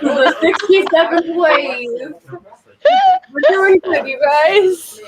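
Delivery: put-on high, drawn-out old-timer voice, amused; hammed-up old person, laughing through it; imagined elderly self. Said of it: "like, eighties and nineties".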